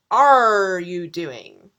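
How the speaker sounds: "are" blasted loud and drawn out like a pirate's "arr"; pirate-style stress on ARE. Shown as a demonstration. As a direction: stretch "are" into a big slow pirate growl. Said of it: "Barbossa is acting like a pirate".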